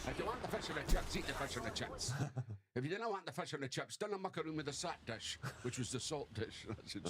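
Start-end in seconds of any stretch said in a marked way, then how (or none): 0.1-5.4 put-on a light Italian Scottish accent, chip shop owner scolding. Chip shop owner telling off a wee boy, light Italian Scottish accent. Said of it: "they had these Italian Scottish accents".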